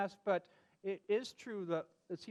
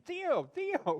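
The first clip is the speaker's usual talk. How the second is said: high, louder call of a name; 11 semitones higher, 5 dB louder than usual. Retold or imitated. Family calling the dog who keeps squeaking, high loud call. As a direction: call across the house.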